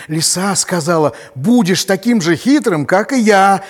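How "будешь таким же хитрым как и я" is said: proud fox voice. Acted.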